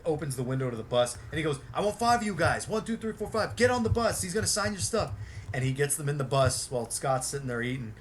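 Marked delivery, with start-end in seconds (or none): 1.8-5.1 higher and louder, barking orders to fans; bossy Billy voice. Retold or imitated. Billy ordering fans onto the bus, loud high bossy voice.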